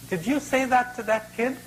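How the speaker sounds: high-pitched storybook rabbit; questioning rabbit. Acted. Rabbit questions the crocodile, high and incredulous. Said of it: "says to the crocodile".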